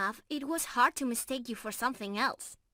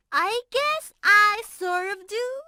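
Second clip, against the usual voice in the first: playful laugh; 6 semitones higher, 8 dB louder, slower than usual. Real, not acted. Teasing about human admirer.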